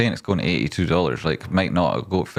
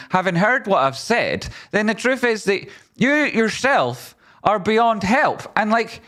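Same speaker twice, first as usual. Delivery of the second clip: exasperated rant; 13 semitones higher than usual. Real, not acted. Ranting at critics on stream.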